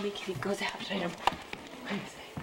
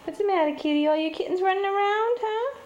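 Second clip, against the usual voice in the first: high, loud cooing; sweet high talk to the cat; pet talk; 10 semitones higher, 6 dB louder than usual. Real, not acted. Asking the mother cat what's wrong, high pet talk.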